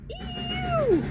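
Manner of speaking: very high squeal; pig squeal. Acted. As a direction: squeal it like a hog. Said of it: "who screamed".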